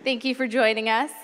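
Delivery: ordinary delivery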